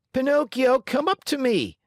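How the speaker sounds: high, loud calling shout. Acted. Harlequin calls Pinocchio up to the stage, shouting. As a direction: call him up onto the stage. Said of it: "shouted Harlequin".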